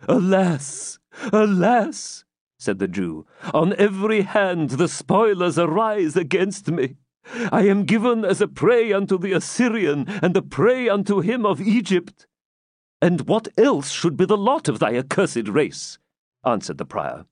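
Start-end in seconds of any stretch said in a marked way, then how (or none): 0.1-2.2 put-on high, wailing old-tale lament; despairing lament. A narrator's reading. 3.5-12.3 high, plaintive character voice; despairing Isaac. A narrator's reading. Narrator voices lamenting Isaac. 13.0-16.0 higher-pitched, lightly voiced scornful prior; haughty scorn. A narrator's reading. Prior scorns Isaac in reply.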